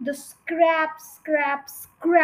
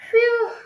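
high, loud exhalation of relief; relieved "phew"; 10 semitones higher, 9 dB louder than usual. A narrator's reading. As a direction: let out a big phew. Mister Fox relieved after digging.

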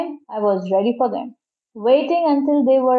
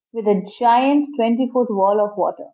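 ordinary delivery, nothing marked

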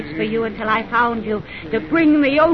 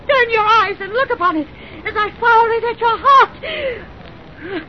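vengeful command; 8 semitones higher, 5 dB louder than usual. Acted. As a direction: order the victim to face death. Avenger aiming gun.

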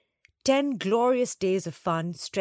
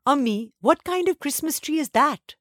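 sobbed question; 8 semitones higher, 5 dB louder than usual. Acted. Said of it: "sobbed Alifia".

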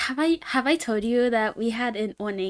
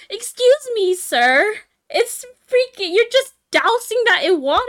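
raised, loud mock-indignant retort; exasperated, pitched-up comeback; 8 semitones higher, 6 dB louder than usual. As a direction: tell him off in a retold huff. Acted indignant comeback to the man.

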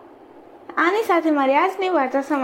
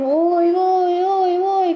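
drawn-out, higher mock cries of pain; pretend wailing; 5 semitones higher, much slower than usual. Wife faking a cut nose for the neighbour, pretend wailing. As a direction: wail slowly as if hurt, hammed-up. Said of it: "કરી બૂમો પાડવા લાગી".

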